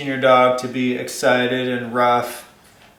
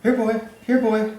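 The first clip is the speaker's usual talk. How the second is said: much higher, sing-song call to a dog; imitating an eager guest; 9 semitones higher than usual. House guest calling the dog to jump up, high sing-song call. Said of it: "you'll see the person do this".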